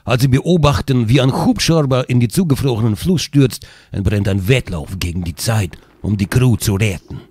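put-on low, epic Russian accent narration; russian accent in german. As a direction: read the intro in a Russian accent. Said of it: "bitte mit russischen, ne, Akzent". (tiefer, epischer russischer Akzent)

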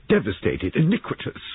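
bitter outrage rising high. A narrator's reading. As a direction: condemn it, climbing in pitch. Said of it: "she added bitterly".

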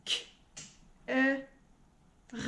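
sounds split one by one; robot voice. Shown as a demonstration. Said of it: "on le dit en robot". (voix de robot, sons séparés)

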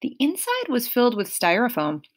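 usual speaking voice

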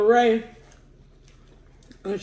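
talking while wiping mouth; muffled through a napkin. Real, not acted. Seen 2: napkin held over mouth while speaking, then removed.

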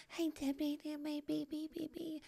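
high, very soft singsong calling a name. Retold or imitated. Singsong calming the kids.

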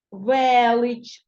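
Велич loud, with a long stressed first Е. Shown as a demonstration. Teacher finding a check word, Велич with stressed Е drawn out. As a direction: hold the Е of Велич wide and strong. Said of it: "ненаголошене е стане наголошеним".

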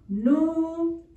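"Nu-me-ro" in long, separated syllables; "Nu-me-ro" stretched out for children writing. Shown as a demonstration. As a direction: dictate "Nu-me-ro" syllable by syllable, holding the M. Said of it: "escribimos número".

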